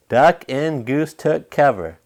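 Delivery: plain reading voice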